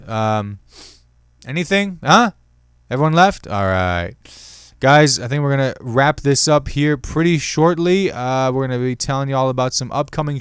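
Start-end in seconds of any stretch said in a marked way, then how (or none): none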